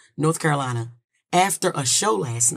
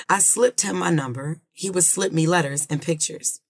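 ordinary delivery

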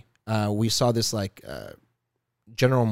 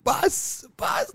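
put-on high squeaky kid voice saying bus; excited little kid; 3 dB quieter than usual. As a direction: imitate a thrilled toddler. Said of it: "He's like".

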